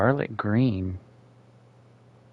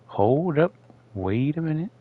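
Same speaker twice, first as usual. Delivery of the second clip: higher, sudden double take; surprised realization; 5 semitones higher, 4 dB quieter than usual. Real, not acted. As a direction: catch yourself, suddenly realizing. Sudden realization, raised pitch.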